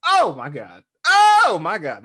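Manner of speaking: a big hyped "oh my God"; retold amazed outburst. Retold awed reaction to the line.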